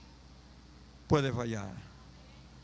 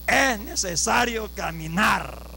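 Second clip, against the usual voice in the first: measured emphasis; slower than usual. Real, not acted. Preacher exhorting brothers to walk, measured emphasis. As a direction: stretch the words out without shouting.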